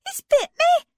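high, loud, alarmed exclamation; shocked girl crying out loud; startled cry. Acted. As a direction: yelp it out as the pain hits. Girl bitten by a mole.

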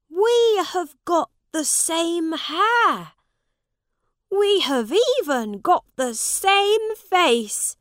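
high, loud princely wonder; excited prince making a discovery; amazed young prince. Acted. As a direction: marvel loudly, pitched right up. Prince marvels at the likeness.

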